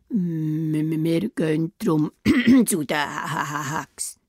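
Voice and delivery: low, stuttering scared wolf; stammering wolf. Acted. The frightened wolf stammering to Rotkäppli. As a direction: stumble over the words in fear.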